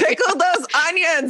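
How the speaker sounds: high, laughing mock command; giggling playful order. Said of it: "Darcy, pickle your onions".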